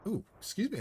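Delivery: hiccup jumps the pitch up high. Real, not acted. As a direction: hiccup in the middle of a word. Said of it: "I got the hiccups".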